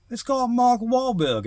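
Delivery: put-on a half-hearted Boston accent like Mark Wahlberg's; a Boston accent, attempted and admitted bad; Mark Wahlberg impression. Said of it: "I can't do his accent".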